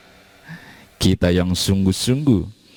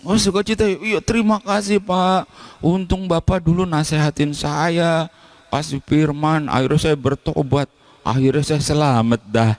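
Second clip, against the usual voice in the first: grateful congregant; 11 semitones higher than usual. Acted. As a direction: thank the pastor who led you to faith, much higher. Grateful congregant thanks pastor.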